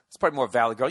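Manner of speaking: ordinary talk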